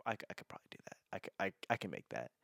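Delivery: half-whispered unsure self-talk, quick at the end; unsure mumble. Retold or imitated. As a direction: mutter it as if talking yourself into it. Timid inner voice, unsure.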